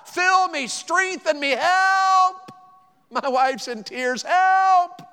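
high, loud, hammed-up cry for help; desperate plea. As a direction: cry out to God, stretching "help". Acts out desperate plea to God.

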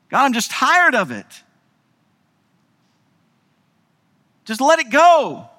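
high, aching prayer; tired of it, begging God; weary plea. Acted.

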